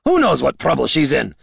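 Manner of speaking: high, irritated outburst; exasperated fuming. Acted. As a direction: fume about the missing companion. Leader fuming over missing companion.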